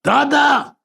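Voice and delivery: put-on high, loud child's call to daddy; instinctive shout. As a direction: call out to Dada, high and sudden. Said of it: "I instinctively shouted out".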